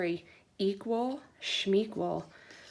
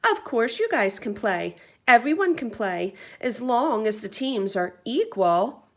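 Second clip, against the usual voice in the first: brave voice; 3 semitones higher, 8 dB louder than usual. Acted. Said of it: "in her bravest voice".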